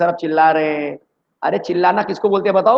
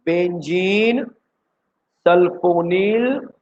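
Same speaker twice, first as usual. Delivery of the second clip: slow spelling out; much slower than usual. Shown as a demonstration. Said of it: "क्या बोला मैंने?".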